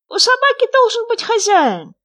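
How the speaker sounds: high, loud, squeaky little frog; small creature's high, loud voice. A narrator's reading. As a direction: pipe up eagerly as a tiny frog. Frog answering the hare.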